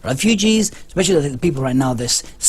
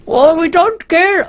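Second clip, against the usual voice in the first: very high, loud, slow mock French accent; a hammed-up French accent, stretched out; 6 dB louder, slower than usual. Retrying the line as the French, mock French accent. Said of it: "I don't know how to say French language".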